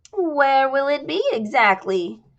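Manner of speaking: high, slow curious squeak; squeaky chorus girl. Acted. Jasmine asking Ryan about the resort, squeaky. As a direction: ask eagerly, squeaky.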